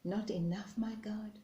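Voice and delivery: soft, faint, hurt question; faint whisper. Acted. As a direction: barely breathe the question out. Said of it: "With a faint whisper she said".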